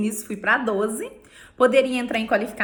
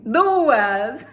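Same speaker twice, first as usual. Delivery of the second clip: laughing exclamation; 3 semitones higher, 3 dB louder than usual. Real, not acted. Laughing at her tiny team.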